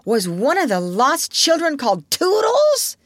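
put-on high, hopeful child's question; crying out. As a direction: ask eagerly, hoping it is you. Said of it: "cried Toodles".

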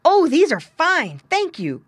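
lightly voiced grateful pig; grunting pig. A narrator's reading. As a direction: answer as a contented little pig. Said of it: "grunted Squinty".

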